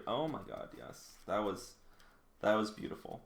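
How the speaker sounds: usual speaking voice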